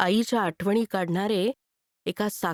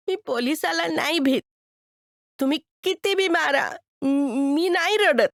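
put-on high, defiant kid's voice; a boy bracing himself, refusing to cry; 7 semitones higher than usual. Boy tells the man he fears no police and won't cry, high and defiant.

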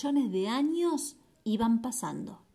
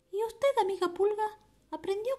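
high louse voice; 10 semitones higher, faster than usual. Acted. Louse asks flea, high.

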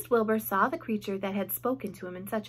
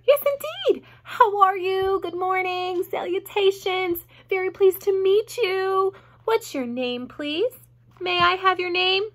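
high-pitched, gushing greeting; thrilled, over-polite piglet; excited; 8 semitones higher, 5 dB louder than usual. A narrator's reading. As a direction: greet a new friend eagerly. Wilbur gushes greetings to new friend.

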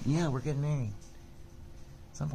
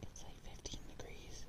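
whispered, much softer; 15 dB quieter than usual. Real, not acted. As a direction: barely breathe the words. Whispering outside at night.

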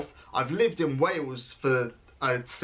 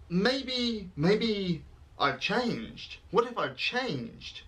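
mock dramatic musing; 7 semitones higher, much slower than usual. Mock existential worry.

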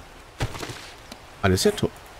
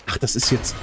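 usual speaking voice

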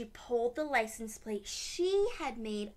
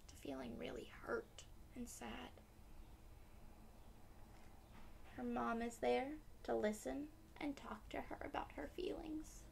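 hushed storyteller whisper; 9 dB quieter than usual. A narrator's reading. Narrator whispering to little listeners. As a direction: breathe the line softly. Reader explains child's sadness softly.

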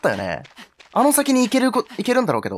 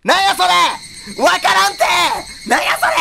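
exasperated shout; 9 semitones higher than usual. Real, not acted. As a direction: yell it out in real outrage. Baffled by game puzzle, shouting.

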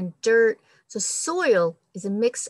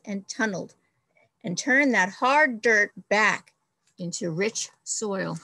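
expressive storybook read-aloud; reading to children. Acted. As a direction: read it like a picture book for the class. Teacher reads picture book aloud.

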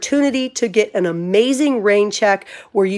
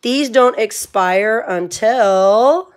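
slow; much slower than usual. Real, not acted. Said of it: "Let me tell you guys".

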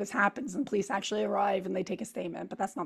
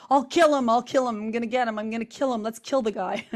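retold angry yell; 3 semitones higher, 6 dB louder than usual. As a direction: belt it out like an outraged stranger. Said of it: "he's, like, screaming".

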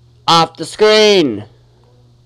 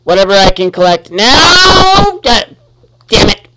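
loud, higher frantic shout ending in a curse; real gamer rage, loud and high; frustrated yell; 6 semitones higher, 5 dB louder than usual. Real, not acted. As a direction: blurt it out as everything goes wrong. Frantic play, then swearing at death.

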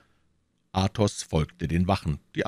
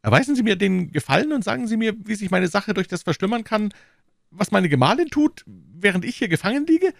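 high, fast anxious pleading; pleading shopkeeper; 4 dB louder than usual. Acted. Prisoner Bonacieux pleading with the commissioner, high anxious voice.

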